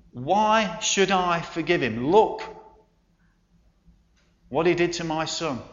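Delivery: understated retold outrage, spaced out for emphasis; retold angry shout. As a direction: demand an answer from God, without really shouting. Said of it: "began to shout at God".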